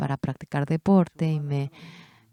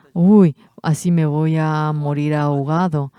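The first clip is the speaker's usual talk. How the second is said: mock fear; 6 dB louder than usual. Pedro fears drowning in waves.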